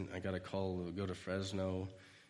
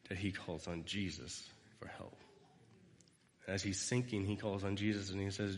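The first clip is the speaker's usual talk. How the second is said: ordinary talk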